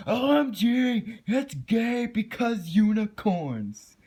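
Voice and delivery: high, slow, whiny mock of a hater. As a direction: sneer it like a dumb troll commenter. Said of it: "don't go saying".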